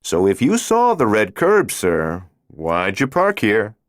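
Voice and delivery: a cop calmly pressing his point; friendly cop. A narrator's reading. Patrolman questioning the parked driver, mildly probing.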